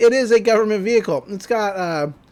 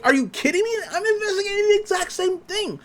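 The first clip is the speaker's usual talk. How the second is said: put-on very high-pitched excited kid; thrilled disbelief; 13 semitones higher than usual. Dipper finding fellow investigators, acted thrilled disbelief.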